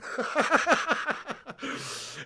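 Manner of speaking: high run of giggling laughter; laughing. Real, not acted. Streamer with no props to hand, helpless laughter. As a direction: crack up at your own failed joke. Laughing at his failed prop joke.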